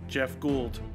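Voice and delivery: ordinary delivery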